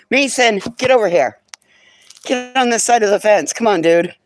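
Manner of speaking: loud call across the yard. Real, not acted. Calling dog across the fence.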